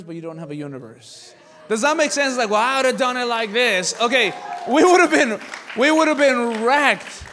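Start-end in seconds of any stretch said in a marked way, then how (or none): 1.7-7.2 louder, higher shouted preaching; loud preaching burst. Acted. Pastor mocking a know-it-all, loud shouted preaching. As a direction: raise the roof on this point. Preacher erupts mid-point.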